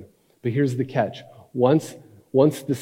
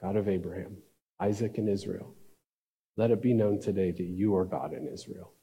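soft, lower, measured prayer; calm prayer; 4 semitones lower, 7 dB quieter than usual. Acted. Elijah prays before the people, calm and hushed. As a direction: pray quietly, with no show at all. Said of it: "Then he calmly prays".

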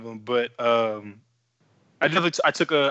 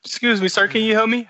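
raised, loud polite customer; 8 semitones higher, 5 dB louder than usual. Pretend. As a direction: ask sweetly for help. Acting customer asking for help.

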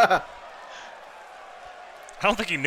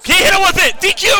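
excited shout; 12 semitones higher, 10 dB louder than usual. Real, not acted. Cheering a game move.

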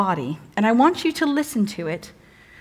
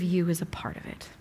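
whisper; 4 semitones lower, 11 dB quieter than usual. Acted. Closing line of the scripture reading.

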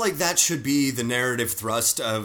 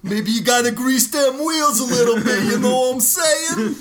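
higher, gruff wrestler promo voice; Macho Man voice; 7 semitones higher, 4 dB louder than usual. Retold or imitated. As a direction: play it like a shady wrestling tough guy. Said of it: "that was my Macho Man, Reggie Savage".

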